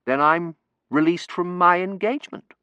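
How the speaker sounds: dawning relief. Acted. Terence realises he is free.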